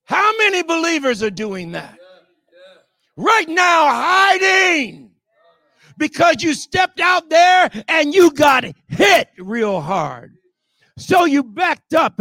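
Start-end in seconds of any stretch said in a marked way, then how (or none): 3.2-12.2 loud, high, slow preacher's cry; full-throated sermon shout; preaching at full force. Acted. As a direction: drive it home from the pulpit.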